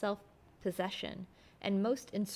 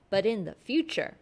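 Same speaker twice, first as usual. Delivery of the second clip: "in the future" hit hard and loud; stress on in the future; 7 dB louder than usual. Acted. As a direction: lean hard on "but in the future" as a warning. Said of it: "I laid marked stress on the words".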